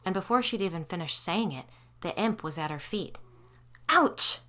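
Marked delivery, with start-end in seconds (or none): 3.9-4.4 hissed ouch. Acted. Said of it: "she hissed".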